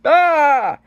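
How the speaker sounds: loud, high mock scream of alarm; reacting scream. Shown as a demonstration. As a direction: cry out as if startled. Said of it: "and you're reacting".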